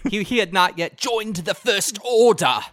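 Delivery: mock film villain giving orders, loud and declaimed; hammed-up military commander, stretching the words; Domhnall Gleeson. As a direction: bellow it like an over-the-top movie officer. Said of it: "they didn't cast Domhnall Gleeson yet".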